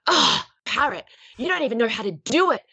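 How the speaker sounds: huffy, scornful put-down; exasperated scolding. Acted. Daughter scolding her pet parrot, exasperated. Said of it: "and then go".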